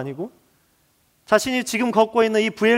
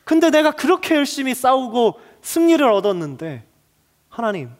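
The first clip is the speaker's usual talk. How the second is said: anguished protest; 5 semitones higher than usual. Acted. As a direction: pour out the frustration of a prophet who has done enough. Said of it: "일상의 언어로 해석해 보면 이렇습니다".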